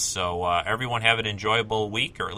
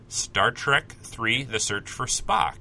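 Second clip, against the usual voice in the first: slow title announcement; slower than usual. Real, not acted.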